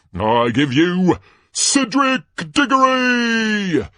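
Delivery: high, stretched phrases rising to a shout; Ludo Bagman, rousing announcer. Acted. Announcer bringing out the first champion, rousing and loud. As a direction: whip up the crowd toward the name.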